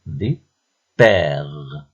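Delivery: "Vipère" as vi, clear pause, long père; drawn-out "Vipère", broken in two; split into syllables. Shown as a demonstration. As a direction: show the second syllable of "Vipère". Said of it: "on a coché la deuxième syllabe".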